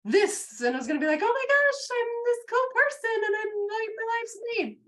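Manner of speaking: high, loud gushing self-quote; mock excited gushing. Coach mocking a dreamer's giddy gush, high and loud. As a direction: ham up the thrill of a big win. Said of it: "and you're like".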